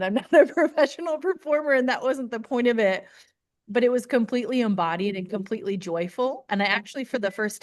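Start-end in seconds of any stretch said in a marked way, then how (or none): 0.0-3.1 self-deprecating laugh. Real, not acted. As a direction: laugh it off lightly. Laughing at herself.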